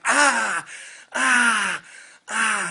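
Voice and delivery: mock cartoon groans of pain, ah ah ah; Peter Griffin pain groans. Dad hamming up his fall off the ladder, mock pained groans. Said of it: "As I'm in pain like Peter Griffin".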